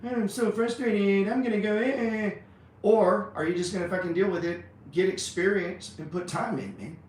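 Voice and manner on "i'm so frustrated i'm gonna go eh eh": high, whiny mock sob about being frustrated; hammed-up crybaby whimper, pitched way up; mock crying. Mocking a frustrated trader for his viewers, high whiny mock crying. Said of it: "or you gonna be crying".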